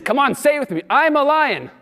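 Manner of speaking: high, loud, laughing roar of a cry; rally the whole hall to roar; rousing shout. Retold or imitated. Yogananda urges congregation to roar.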